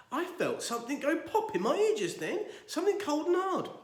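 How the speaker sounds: mock surprise. Feigned surprise.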